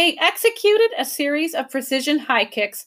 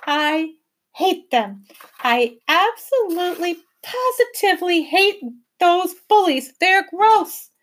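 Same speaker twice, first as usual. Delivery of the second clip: higher, tight hatred building to loud; through clenched teeth; 8 semitones higher, 3 dB louder than usual. A narrator's reading. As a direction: spit out the disgust through teeth. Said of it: "said through clenched teeth".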